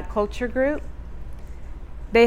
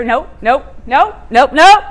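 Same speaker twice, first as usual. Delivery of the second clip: stubborn refusal; 3 semitones higher, 7 dB louder than usual. Retold or imitated. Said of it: "I'm like".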